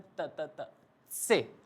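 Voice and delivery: teacher breaking down the German C sound; t then tse drill. Shown as a demonstration. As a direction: build से from त: त, त, त, से. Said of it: "ऊपर से शुरू करना है".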